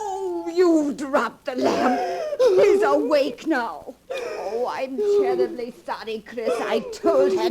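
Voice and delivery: crazed excitement; agitated. Acted. Lamp dropped, waking the dead.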